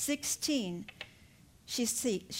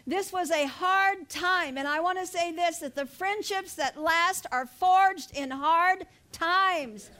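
slow, rising preacher emphasis; preaching; 4 semitones higher than usual. Acted. Preacher urging the congregation about hard-time friendships. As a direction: press each word home.

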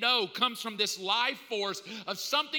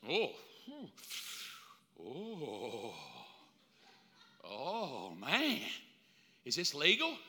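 lower, softer dazed oh; stoned reaction; 7 semitones lower, 7 dB quieter, slower than usual. Acted. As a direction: drift off in a dazed haze. First hit of marijuana.

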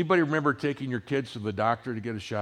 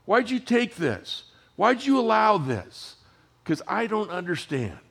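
pleading; 10 semitones higher than usual. Retold or imitated. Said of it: "I'm saying".

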